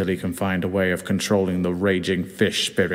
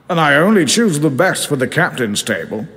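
higher, hearty sea captain voice; grand old skipper welcoming the kid, higher voice; Captain Birdseye; 6 semitones higher than usual. Acted. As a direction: play the cigar-puffing captain, pitched up. Captain keeps talking.

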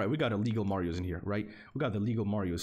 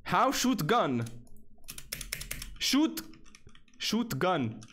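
mock caveman; 7 semitones higher, slower than usual. Real, not acted. Joking caveman speech.

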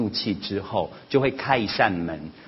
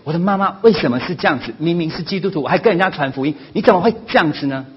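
high, loud exasperated whine; indignant child; 8 semitones higher, 7 dB louder, faster than usual. Retold or imitated. Son voicing his embarrassment about his mother, high exasperated whine. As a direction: sound fed up with your mother. Son quotes what others think.

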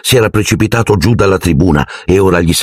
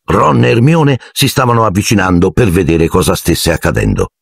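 plain reading voice

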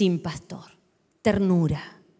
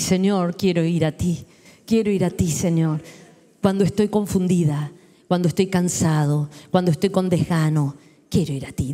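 humble prayer; 8 semitones lower than usual. Acted. Preacher voices believer's humble prayer.

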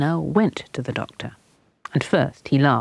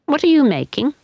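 put-on little girl's voice; 12 semitones higher, 4 dB louder than usual. A narrator's reading. Ina asks the boy.

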